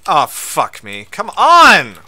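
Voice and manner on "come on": loud, high cry of exasperation; real raised exasperated yell; frustrated shout. Real, not acted. Dying in game.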